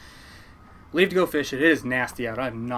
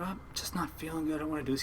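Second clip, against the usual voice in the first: feeble complaint; 10 dB quieter, faster than usual. Retold or imitated. Sick kid answering a demanding uncle, feeble quiet complaint. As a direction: mumble a reluctant excuse under your breath.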